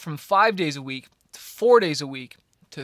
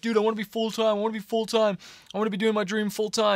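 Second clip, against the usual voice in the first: higher mock-whine about wanting to go full-time; whiny complaining; 5 semitones higher, faster than usual. Complaining to his semi-manager about wanting to go full-time, mild mock whine. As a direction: lightly play the impatient young artist nagging. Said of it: "I was always complaining".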